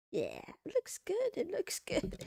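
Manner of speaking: high-pitched, giddy approval; getting carried away, voice jumping up high; real excitement, pitched well up; too excited. Real, not acted. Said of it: "I'm getting too excited".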